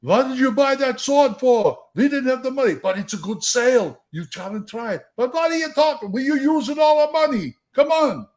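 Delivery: put-on high-pitched Yiddish-accented bickering. Two shopkeepers arguing over a sword, high Yiddish accent.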